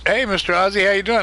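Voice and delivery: usual speaking voice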